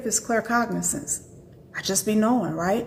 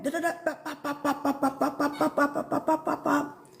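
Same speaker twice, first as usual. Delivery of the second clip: mock rapid chatter; 3 semitones higher, 5 dB quieter than usual. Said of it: "And I'm going".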